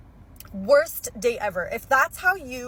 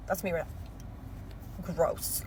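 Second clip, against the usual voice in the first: disgusted whisper; 4 semitones lower, 11 dB quieter, much slower than usual. Real, not acted.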